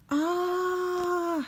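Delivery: very high screaming "ah". Acted. James in the crash, very high screamed "ah". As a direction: let out a piercing shriek. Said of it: "screamed James".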